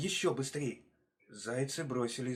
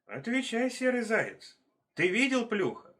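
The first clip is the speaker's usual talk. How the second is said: stern questioning; 9 semitones higher, 4 dB louder than usual. Acted. Leopard interrogates the hare.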